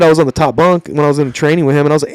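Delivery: ordinary talk, nothing marked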